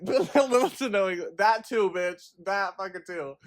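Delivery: high, giggly words through laughter; laughing at herself. Real, not acted. Catching her slip about English, laughing and high-pitched. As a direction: crack up over your own slip. Cracks up at own language slip.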